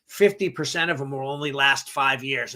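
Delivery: normal talking voice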